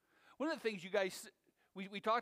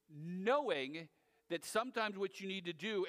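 usual speaking voice

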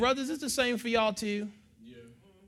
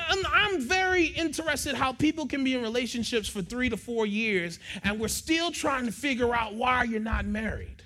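normal talking voice

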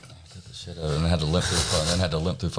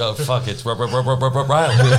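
stuck on "R-", rising and louder to "Riley"; mock stutter on a name; 5 semitones higher, 4 dB louder than usual. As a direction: hammer out "R-" over and over, building up louder. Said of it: "Jesus was like".

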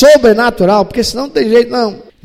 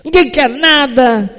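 loud, higher self-righteous griping; whiny complaint; 7 semitones higher, 4 dB louder than usual. Retold or imitated. Elias complaining to God about the church, loud and self-pitying. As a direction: vent like a bitter churchgoer. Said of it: "igual a muita gente religiosa costuma fazer".

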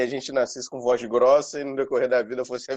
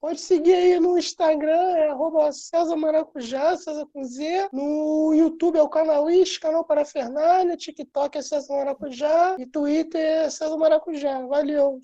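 chipmunk voice; 12 semitones higher than usual. Pretend. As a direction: squeak it out thin and high. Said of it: "bora encerrar com a voz fina".

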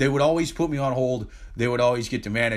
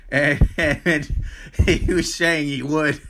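laughing; slower than usual. Real, not acted. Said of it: "That is hilarious".

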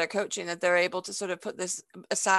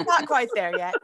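laughing; 3 semitones higher, 5 dB louder than usual. Real, not acted. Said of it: "I'm still a little salty".

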